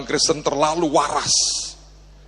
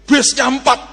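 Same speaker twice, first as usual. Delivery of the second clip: high and loud exclaim; stressed exclamation; excited emphasis; 10 semitones higher, 6 dB louder than usual. Real, not acted. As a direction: preach it with rising excitement.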